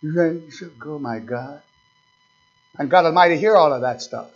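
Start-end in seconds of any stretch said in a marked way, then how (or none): 0.0-1.6 very soft, muttered chatter; mock gossiping. Church members gossiping within God's hearing, mocking mutter. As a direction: mutter it like busy gossips under their breath.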